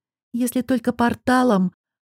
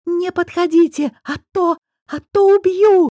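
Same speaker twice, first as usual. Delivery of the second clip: high desperate scream, cornered and panicking; screaming threat; 10 semitones higher, 5 dB louder than usual. A narrator's reading. Cornered Nayra screams at the girls to stay back. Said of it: "завопила Найра".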